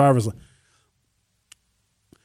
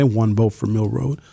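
ordinary delivery

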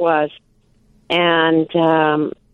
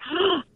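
sharp high gasp, quick and breathless; excited gasp; 11 semitones higher, 8 dB quieter than usual. Retold or imitated. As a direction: catch your breath in surprise. Retold caller gasps at news.

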